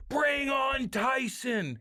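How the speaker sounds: high, drawn-out emotional play-by-play call; Rick Jeronette. Retold or imitated. Announcer calling the end of a hockey fight, emotional imitation. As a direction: imitate an announcer carried away, stretching every word. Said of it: "He was so emotional".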